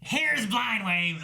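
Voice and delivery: slow, drawn-out mock Gottfried announcement; Gilbert Godfrey impression. Cohost imagining Gottfried narrating, slow hammed-up impression. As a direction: stretch it out as a bad Gilbert Gottfried. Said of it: "I can't do it".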